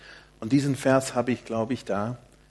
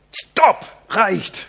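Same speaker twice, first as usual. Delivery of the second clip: much higher, louder sharp snap of stopp and reicht; snapping at kids; 8 semitones higher, 7 dB louder than usual. Retold or imitated. Wife snapping at the children to stop, loud high snap. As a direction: bark it out like a mother stopping her children cold. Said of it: "Sie schnauzt die Kinder an".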